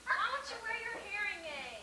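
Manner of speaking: loud, high exasperated question; shouting. Acted. Scolding deaf Grandma about her hearing aid, exasperated shout. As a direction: holler it at someone hard of hearing. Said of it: "sore throat from shouting at Grandma".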